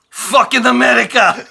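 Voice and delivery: put-on loud, high, slow accented curse at America; hammed-up immigrant rage, drawn out; mock outburst. Mock immigrant cursing his luck.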